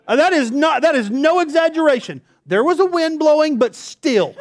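loud and high, laughing through it; laughing insistence. Real, not acted. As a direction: insist it's true while cracking up.

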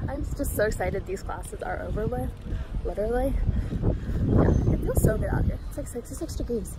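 breathless while walking; slightly winded talking; out of breath. Real, not acted. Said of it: "I don't know why I'm out of breath right now".

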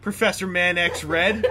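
laughing nickname. Real, not acted. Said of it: "You acquired a new nickname".

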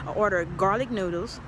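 ordinary talk, nothing marked

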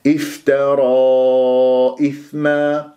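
slow recitation holding the last a of افترى before إثما; lengthened madd. Shown as a demonstration. Teacher showing the elongation, long madd on افترى. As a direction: stretch افترى long, then strike the hamza of إثما. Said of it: "بهذه الطريقة".

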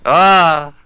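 startled yell. Real, not acted. Flashed and panicking in game.

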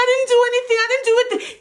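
high-pitched, repeated denial; frantic protest. Retold or imitated. Telling police she did nothing, frantic high protest. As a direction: protest your innocence, voice shooting up.

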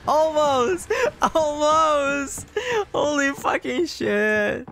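high, crying, breathless relief; shaken relief. Real, not acted. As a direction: gasp it out near tears. Narrow escape, near crying.

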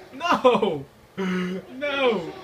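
high loud cries of no; mock wail. Gamer being killed crying out high and loud. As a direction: protest dramatically.

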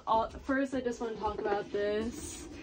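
ordinary talk, nothing marked

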